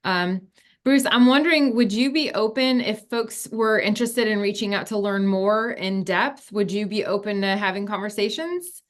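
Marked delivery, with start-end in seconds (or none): none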